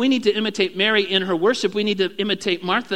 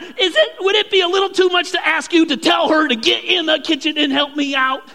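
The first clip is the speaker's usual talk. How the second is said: loud, high, fed-up demand for help; exasperated demand; 10 semitones higher, 7 dB louder than usual. Acted. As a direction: snap at Jesus like a harried host. Martha demanding help, acted.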